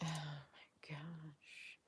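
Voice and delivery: barely audible, slow whispered sigh. Real, not acted. Sighing at alarming events.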